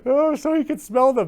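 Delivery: high, loud, amused echo; tickled. Real, not acted. Host echoing mom's story about daughter and roses, tickled. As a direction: repeat it in high-pitched delight.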